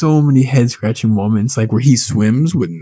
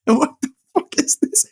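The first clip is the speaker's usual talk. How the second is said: very high-pitched mock disbelief; incredulous squeal; 14 semitones higher than usual. Friend retelling the base camp twist, incredulous squeal. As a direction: squeak it in comic bewilderment. Repeated baffled reaction to film.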